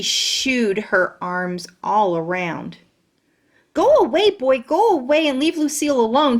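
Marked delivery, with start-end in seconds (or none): 3.7-6.4 high, loud shooing shout. Acted. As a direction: shoo the boy off at full voice. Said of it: "she shouted".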